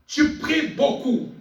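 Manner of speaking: ordinary delivery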